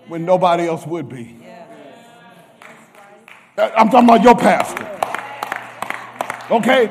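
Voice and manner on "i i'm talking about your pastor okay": building preaching. Real, not acted. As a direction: lift into preaching fervor. Preaching at full force.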